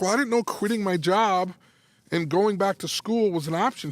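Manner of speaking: high, indignant reenacted reply; retold defensive protest. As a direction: protest as the caught-out husband.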